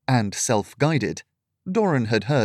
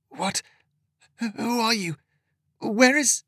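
high, thin rasping voice of a dazed woman; a frail woman jolted from prayer, much higher; 11 semitones higher than usual. A narrator's reading. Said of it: "her voice came out as a thin rasp".

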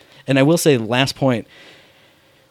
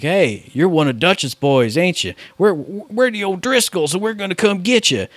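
O'Driscoll gang thug; 6 semitones higher than usual. Retold or imitated. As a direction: taunt him like a swaggering rival gang rider. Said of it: "two dudes showed up on horseback".

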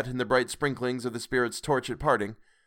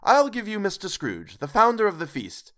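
higher, raised cheerful toast; hearty toast; 8 semitones higher, 3 dB louder than usual. A narrator's reading. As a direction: lift the glass proudly. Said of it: "said Bob".

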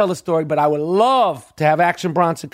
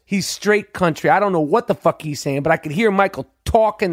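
ordinary talk

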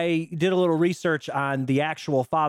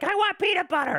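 put-on high, whiny little kid asking for a snack; baby voice. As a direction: play the toddler begging. Quoting baby Stu's line.

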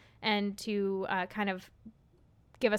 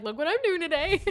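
excited; 7 semitones higher, 5 dB louder than usual. Retold or imitated. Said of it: "I was like".

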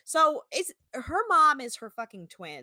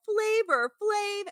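mock yell; 9 semitones higher, much slower than usual. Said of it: "can you just yell".

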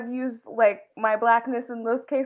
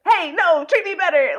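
louder, higher mock demand; assertive protest; 6 semitones higher, 6 dB louder than usual. Standing up to bad service, loud assertive protest. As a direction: speak up firmly and push back.

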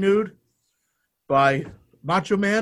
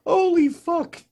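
high mock voice; 12 semitones higher, much slower than usual. Mocking reaction, high pitched.

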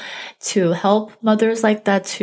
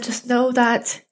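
higher, near tears, voice catching; emotional; 6 semitones higher than usual. Real, not acted. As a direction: reassure while fighting back tears. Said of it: "I do still feel very emotional".

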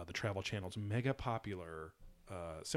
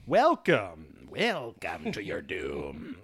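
slow, drawn-out sinister welcome; ominous villain voice; 3 semitones lower, 6 dB louder, much slower than usual. Pretend. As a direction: greet them like a doom-bringing villain. Mock villain greeting.

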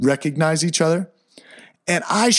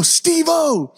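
excited call; 13 semitones higher than usual. Retold or imitated. Calling out to a passing friend.

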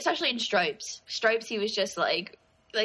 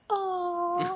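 mock sad oh; 10 semitones higher than usual. Art tribute to dead actor.

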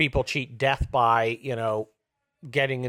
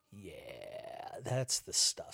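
low, soft, gloating sigh; hushed mock bliss; savouring; 7 semitones lower, 13 dB quieter, much slower than usual. Host relishes humiliating his critics, quietly savouring.

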